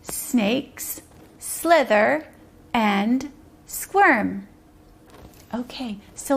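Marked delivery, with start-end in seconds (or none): 0.0-4.5 very slow, one word at a time; slow message reading. Shown as a demonstration. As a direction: say each word separately, with clear pauses. Repeats message for children.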